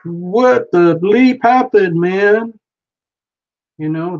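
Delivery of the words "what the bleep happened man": slow, loud, high-pitched disbelief; hammed-up baffled outcry; mock bewilderment. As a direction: stretch each word in comic dismay. Acts the bewildered drawn figure.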